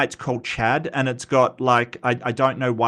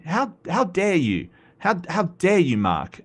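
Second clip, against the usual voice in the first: hammed-up indignant gasp, pitched higher; mock outrage; 5 semitones higher than usual. Teasing a chat viewer who nudged him, mock outrage. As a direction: play offended at the viewer, not meaning it.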